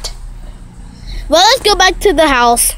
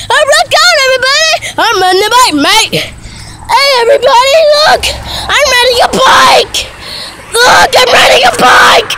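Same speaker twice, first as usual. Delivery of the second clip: excited yelling; 9 semitones higher than usual. Real, not acted. Vlogger finally riding the bike, excited yelling. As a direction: shout it out to everyone around.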